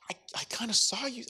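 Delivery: fast, hesitant whisper; mock timid whisper, hurried; whispered accusation. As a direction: whisper it like you're not sure.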